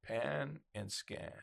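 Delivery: very slow, soft, measured words; deliberate, drawn-out demonstration; Terminator-like scanning. Shown as a demonstration. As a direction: stretch it out like a slow robotic scan. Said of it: "like the Terminator".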